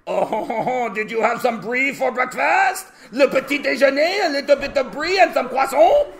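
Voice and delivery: loud, high, hammed-up French accent; cartoon French person, starting with a chortle; exaggerated French impression, high-pitched; mock French accent. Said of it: "French person comes here".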